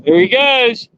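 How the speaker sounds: excited. Real, not acted. Fish being released.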